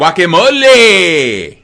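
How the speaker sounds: Guacamole! stretched slow and loud in Spanish; slow proud Spanish Guacamole; drawn-out guacamole. Shown as a demonstration. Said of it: "You do guacamole?".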